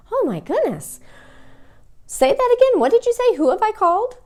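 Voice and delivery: high, loud, bewildered questions; baffled caller. Acted. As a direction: ask as if you caught none of it. Acted baffled caller's reaction.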